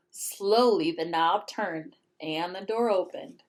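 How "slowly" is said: ordinary delivery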